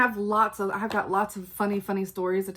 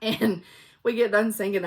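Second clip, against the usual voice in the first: usual speaking voice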